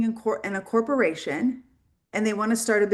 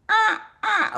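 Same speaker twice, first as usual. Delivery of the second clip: flustered; 11 semitones higher than usual. Retold or imitated. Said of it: "I'm literally sitting in there like".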